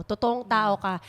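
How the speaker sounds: ordinary talk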